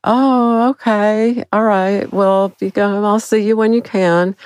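high, naive agreeable voice; dumb nodding. Retold or imitated. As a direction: play the clueless, trusting girlfriend. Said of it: "I'm just nodding dumbly, going".

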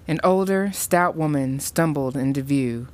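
ordinary delivery, nothing marked

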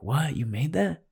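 high, stunned disbelief; mock-astonished parent asking; shocked parents. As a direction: gasp it out like you can't believe your kid made it. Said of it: "And they're like".

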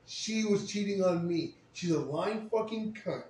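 slow, high and loud outraged yelling; retold furious yell. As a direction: spit it out slowly, furious. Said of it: "I yelled my fucking ass off".

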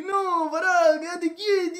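high, stunned no, pará; blurting out in disbelief; shocked. Retold or imitated. Retelling his shock to the girl's request, high and stunned.

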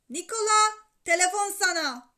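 father calling out crossly; exasperated. A narrator's reading. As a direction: summon him, out of patience. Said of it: "hiç şaka kaldıracak hali yoktu".

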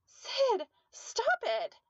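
high, loud scream to stop; desperate yell. A narrator's reading. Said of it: "she yelled".

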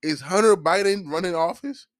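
high, loud disbelieving comeback; high-pitched mock outrage; incredulous retort. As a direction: shoot it back like it's obvious nonsense. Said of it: "I'm like".

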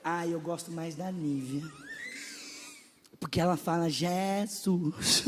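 lightly voiced fussy believer; mock picky churchgoer. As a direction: murmur it like a judgy church member. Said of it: "começa a olhar e falar assim".

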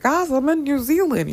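high, bright mock announcement to her viewers; excited announcement. Telling viewers she is flying to New Zealand, excited and high. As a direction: announce it like breaking news. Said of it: "I'm probably gonna end up being like".

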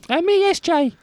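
high, put-on woman's voice; man doing a woman's voice; generic lady voice. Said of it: "was that your impression of her, or was that just a generic lady voice".

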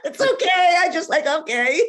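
high-pitched, talking through laughter; real giggly laugh in the words; laughing reassurance. Real, not acted. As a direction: laugh it off as no big deal. Laughing off the mispronounced name.

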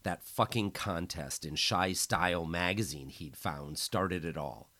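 plain reading voice

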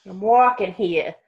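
a light New York tough-guy "I'm walking here"; New York accent. Pretend. Sister plays a New Yorker, light New York accent. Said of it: "You sounded so New Yorkin".

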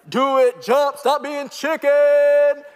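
high, loud, stretched-out heckling yell; teen taunting. Retold or imitated. Teenage preacher yelling at the scared girl, loud taunt. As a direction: jeer at her like a rowdy teenager. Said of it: "I would have been the kid like".

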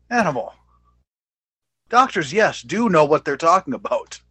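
high, loud, incredulous rebuttal; exasperated rant. Real, not acted. Podcaster rebutting a wrestler's claim, exasperated.

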